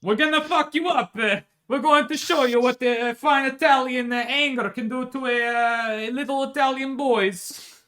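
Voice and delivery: high, loud hammed-up Italian threat; cartoon Italian soldier bravado; mock Italian tough. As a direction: growl out a swaggering Italian threat. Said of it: "French again".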